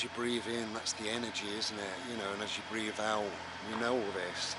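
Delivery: talking over a loud tractor; tractor rumbling behind. Real, not acted. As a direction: raise the voice over engine noise. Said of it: "Sorry about the tractor".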